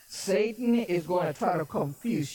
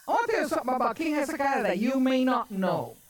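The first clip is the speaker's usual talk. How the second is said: ordinary talk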